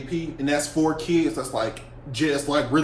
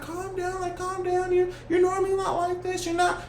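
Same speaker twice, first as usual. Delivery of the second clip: soothing teacher. Retold or imitated. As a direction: coax her to calm down, way up high. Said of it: "she just like".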